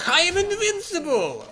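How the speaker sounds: put-on booming, high Russian-accented boast, slow; hammed-up Russian accent, laughing through it. Streamer mocking a game character: loud hammy Russian accent. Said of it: "so much better with a Russian accent".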